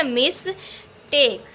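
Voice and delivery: "Mistake" as two separate beats, "मिस / टेक"; syllable by syllable. Shown as a demonstration. Teacher showing two syllables, "mistake" split apart. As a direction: pause between "मिस" and "टेक". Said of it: "जैसे मैं बोल रही हूँ ना".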